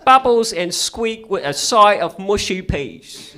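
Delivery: high, loud, slow mock British accent. Comic mocking British dish names in accent. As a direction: ham up a posh British food order. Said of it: "you want me to do the accent".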